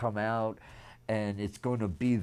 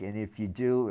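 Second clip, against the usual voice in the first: ordinary talk, nothing marked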